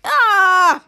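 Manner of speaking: loud, high "ah"; acted scream at a nasty surprise; short hammed-up scream; startled scream. Character sprayed with spicy milk.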